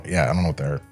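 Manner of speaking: usual speaking voice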